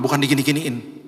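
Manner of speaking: ordinary talk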